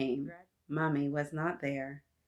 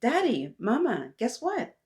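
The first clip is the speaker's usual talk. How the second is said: put-on high, excited child's announcement; smiling, pitched-up call to parents; joyful excitement; 7 semitones higher, 4 dB louder than usual. A narrator's reading. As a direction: burst in with big news. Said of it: "smiling in joy".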